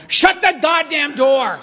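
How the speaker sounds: high, loud, drawn-out bar-room shout; acted shout at whoever opened the door; angry yell. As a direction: yell it across the bar in irritation. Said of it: "everybody turns as if on one axis and yells".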